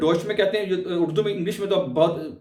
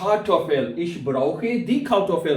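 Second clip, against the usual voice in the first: 1 slow German model; much slower than usual. Shown as a demonstration. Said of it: "मुझे कार्टोफल चाहिए।".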